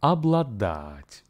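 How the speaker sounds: обладать slow, first syllable as ab, stress on дать; обладать with ab at the start, slowed; slow model word. Shown as a demonstration. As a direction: say обладать slowly, ab-la-DAT. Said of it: "And it sounds like".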